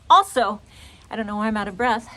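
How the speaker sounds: ordinary talk, nothing marked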